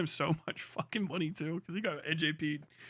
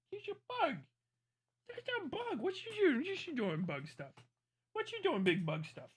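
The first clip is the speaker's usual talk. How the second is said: high sing-song pet talk; talking to a dog; 10 semitones higher, 4 dB quieter than usual. Real, not acted. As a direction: coo at the dog.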